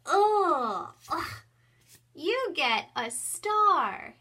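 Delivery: high, loud and slow, a big drawn-out "you get a star"; exaggerated delight. Shown as a demonstration. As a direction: make the reward feel huge. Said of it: "then I go".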